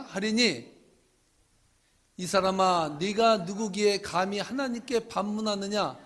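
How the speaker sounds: loud, measured reading of Scripture; solemn scripture reading. Acted. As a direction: proclaim the verses to the church. Reading Romans aloud.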